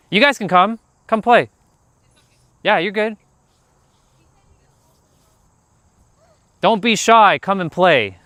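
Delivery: high, loud friendly call across the street. Real, not acted. Streamer calling to kids on bikes, high and loud call.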